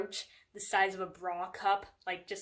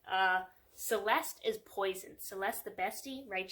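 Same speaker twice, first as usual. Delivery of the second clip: ordinary talk, nothing marked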